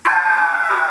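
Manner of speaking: loud breathy scream; falling scream. Acted. Mama screaming while falling. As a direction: scream as she drops from the plane. Mother falling through the sky.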